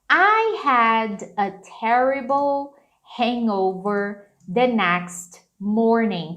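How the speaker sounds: slow, careful English model line; slow model sentence. Shown as a demonstration. Teacher reading slowly to students. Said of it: "Então, ficaria assim".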